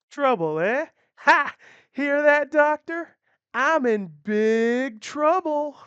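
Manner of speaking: loud, high mock-scared sneer; loud mocking taunt. Outlaw mocking the girl to the doctor, loud and sneering. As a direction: laugh at her threat, slow and loud. Outlaw mocks the girl's threat.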